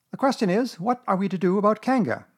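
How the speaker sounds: high-pitched impatient question; impatient Piglet. A narrator's reading. Piglet pressing Pooh about Kanga, impatient. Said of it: "said Piglet impatiently".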